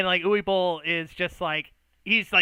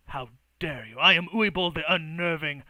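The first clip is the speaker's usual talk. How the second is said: affronted mage; 6 dB louder than usual. Acted. Mage rebuking those who insulted him, grandly indignant. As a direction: proclaim your titles with offended pomp.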